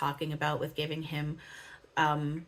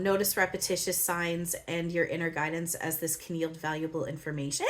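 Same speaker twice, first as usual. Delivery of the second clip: ordinary talk, nothing marked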